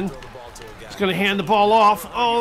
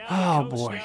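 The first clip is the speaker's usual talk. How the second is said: ordinary delivery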